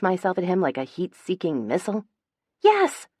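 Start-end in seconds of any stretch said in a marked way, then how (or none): 2.6-3.1 a much higher, louder burst of a yes; near yell. A narrator's reading. Lila urging Phoebe on, a high loud yell. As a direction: shout it out in eager agreement. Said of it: "she practically yells".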